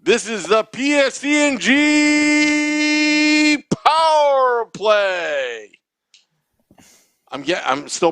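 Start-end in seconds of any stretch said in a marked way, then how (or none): 0.0-5.7 booming high drawn-out hockey PA call; arena announcer. Shown as a demonstration. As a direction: stretch the letters out like a stadium announcer.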